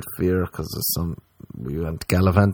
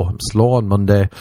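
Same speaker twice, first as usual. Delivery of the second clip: usual speaking voice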